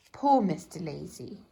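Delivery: plain reading voice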